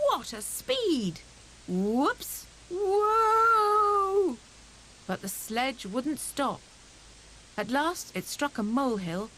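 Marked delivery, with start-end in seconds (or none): 0.1-4.4 slow, wide-eyed cry rising to a high loud whoa; thrilled. A narrator's reading. Hare on the speeding sledge, thrilled shout. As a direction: let the ride carry you away.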